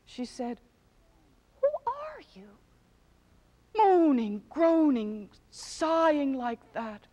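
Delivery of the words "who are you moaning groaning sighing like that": surprised. A narrator's reading. Startled queen questions creatures.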